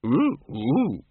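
two swooping hoots, up and down; ape hooting. Acted. The librarian retorts to Windle, swooping double hoot. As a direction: answer in expressive ape oohs.

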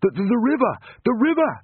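high, stammering panicked cry; breathless, urgent shout; panting alarm. A narrator's reading. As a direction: stumble over the words, then yell the warning. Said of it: "panted one of the coxes".

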